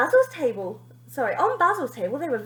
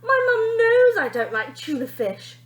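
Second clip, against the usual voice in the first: grumble; 11 semitones higher, 7 dB louder than usual. A narrator's reading. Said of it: "grumbled Tulip".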